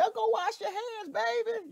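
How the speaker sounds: put-on high, sweet mother calling her child; mama's voice. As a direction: coo it like a mother to her kid. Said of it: "Your mama say".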